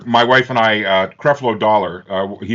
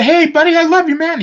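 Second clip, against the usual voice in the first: very high, loud appeal; butter up a friend, overdone; acted-out high-pitched pleading; pleading with buddy; 4 dB louder than usual.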